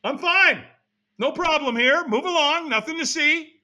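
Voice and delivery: high-pitched, falsely breezy denial; put-on reassurance. Not okay but waving the helper off, falsely fine. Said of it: "when we're not okay, what do we say".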